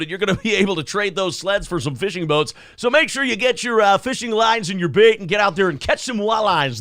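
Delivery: booming upbeat radio DJ; big cheesy morning radio host; radio announcer voice. Acted. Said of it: "it sickens me how good you are at that".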